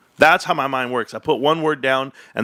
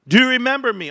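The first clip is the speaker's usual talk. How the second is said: higher, still triumphant, "you" stressed; taunting repeat; 9 semitones higher than usual. Acted. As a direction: press the gloat once more. Calling out to doubters.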